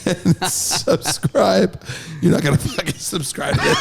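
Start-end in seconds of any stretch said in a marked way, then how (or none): 0.0-1.8 drawn-out word through laughter; laughing. Real, not acted. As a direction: laugh while you stretch the word out. Jokey plea to subscribe.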